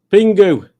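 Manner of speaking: high, loud puppet call. Pretend. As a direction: call out the name in a toy's voice. Voicing a toy for chat message.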